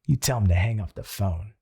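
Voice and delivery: a deep voice that leaves no room for doubt; bold inner voice. Retold or imitated. His bold self ordering the stranger hung up, low and firm. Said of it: "And I was like".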